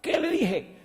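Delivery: very high, loud accusing question; angry boss. Retold or imitated. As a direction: catch him red-handed. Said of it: "le dice el doctorazo".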